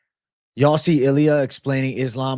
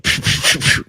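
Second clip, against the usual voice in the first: breathy laugh; 5 dB louder than usual. Real, not acted. Laughs after his impression.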